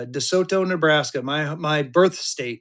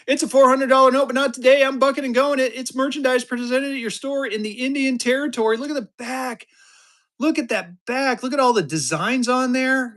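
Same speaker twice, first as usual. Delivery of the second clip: excited; 7 semitones higher than usual. Real, not acted. Raving about note, high voice.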